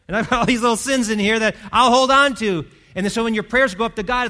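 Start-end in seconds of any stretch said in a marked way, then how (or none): 0.1-2.7 louder, higher voice of a sinner clinging on; defiant clinging. Acted. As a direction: act out stubborn holding back, raised voice. Acting out sinner clinging to sins.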